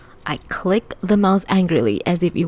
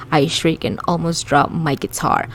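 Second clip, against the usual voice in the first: plain reading voice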